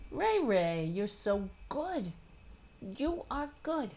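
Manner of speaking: high, soft pet talk; cooing at cat. Real, not acted. Praising her cat Ray in soft, high cooing. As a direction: coo lovingly to the cat.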